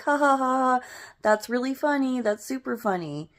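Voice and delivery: high, forced "ha ha" and dry remark; sarcastic, put-on amusement; unamused mock laugh. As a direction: act out pretending it's funny. Mock laughing at the doll scare.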